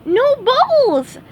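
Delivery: very high, slow, delighted squeak; cartoon sponge thrilled, drawn out; excited. SpongeBob thrilled he has no bubbles, high excited squeak. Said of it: "said SpongeBob excitedly".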